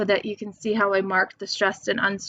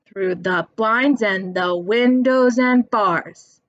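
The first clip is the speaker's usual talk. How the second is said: slow chant, hitting BLINDS, WINDOWS, BARS; sing-song count of three beats; stressed syllables marked; 3 dB louder, slower than usual. Shown as a demonstration. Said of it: "That's three".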